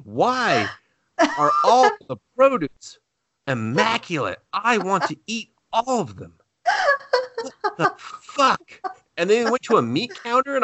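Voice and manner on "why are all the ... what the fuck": incredulous excitement. Retold or imitated. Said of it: "I was like".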